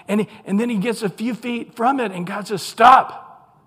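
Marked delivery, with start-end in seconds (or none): 2.6-3.6 sudden loud, high shout; abrupt booming order to halt; commanding shout. Acted. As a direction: halt someone with one forceful word. God halts Moses near the bush.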